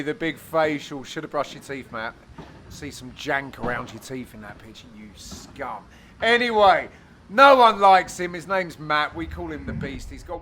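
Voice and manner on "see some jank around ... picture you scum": low, soft aside; muttered mock insult. Commentator sniping at Matt about his teeth, muttered. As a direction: drop right down and grumble the insult.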